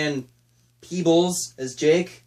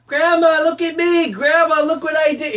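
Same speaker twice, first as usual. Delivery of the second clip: put-on high, loud bratty kid voice; whiny child; 11 semitones higher, 5 dB louder than usual. Child actress calling her grandma, high and loud. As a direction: sing it out like a needy little girl. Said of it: "All of her lines are like".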